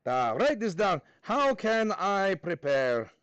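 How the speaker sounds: deliberate put-on Russian accent. Teacher dictating the essential question, mock Russian accent. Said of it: "do this in a Russian accent".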